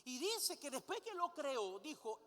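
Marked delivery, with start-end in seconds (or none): none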